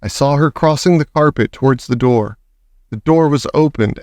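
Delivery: usual speaking voice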